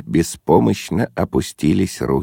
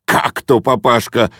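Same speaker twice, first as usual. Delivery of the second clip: ordinary delivery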